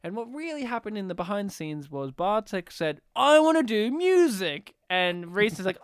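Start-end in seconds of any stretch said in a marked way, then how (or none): 3.1-4.7 higher, louder whiny insistence; co-host mimicking a friend demanding the music section; mock eager demand. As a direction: whine it like a kid who wants a turn. Imitating Bartek's demand.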